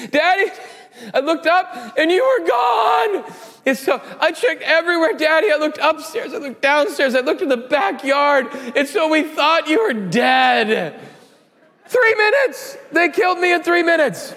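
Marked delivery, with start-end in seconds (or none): 0.1-11.0 put-on high, wailing child's voice, half-laughing; panicked little girl. Daughter telling Daddy she thought he was dead, high wailing child. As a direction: play the sobbing daughter who thought Dad was gone.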